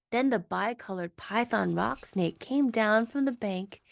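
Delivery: ordinary delivery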